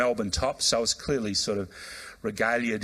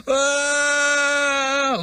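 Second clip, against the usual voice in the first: sudden piercing wail, much higher and louder; loud anguished cry; 6 dB louder than usual. Retold or imitated. Dying man breaking down when offered prayer, piercing wail. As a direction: let out a raw scream like a child. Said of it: "like a child screaming".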